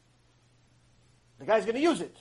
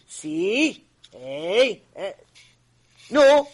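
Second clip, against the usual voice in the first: taunting; 6 dB louder than usual. Acted.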